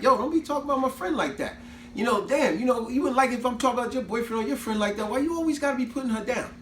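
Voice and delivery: retold heated defence of her friend; indignant comeback.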